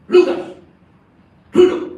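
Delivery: explosive retold healing order; commanding. Preacher orders the sick man up, loud command. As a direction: call out the command with force, in short bursts.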